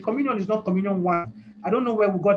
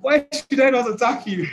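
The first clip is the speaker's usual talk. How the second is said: higher, louder demon voice, laughing after; demon retorting; 5 semitones higher, 6 dB louder than usual. Acted. Demon answering someone who asks why it attacks, higher and louder retort. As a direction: throw it back as an indignant demon. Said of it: "That's what the demon will say".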